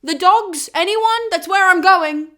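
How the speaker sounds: high, loud ferryman's call for passengers. Retold or imitated. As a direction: hawk the ride like a bored ferryman. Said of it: "Charon ... is like".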